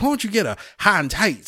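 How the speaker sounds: a drill sergeant pushing a recruit toward a haircut; lightly voiced drill sergeant; mock drill sergeant. Drill sergeant repeats the question.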